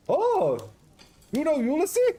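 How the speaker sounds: high, loud hammed-up Greek-Scottish accent; a jolly Greek cook with a Scottish lilt, up high and loud; garbled Greek-Scottish accent. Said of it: "Garbled Greek Scottish".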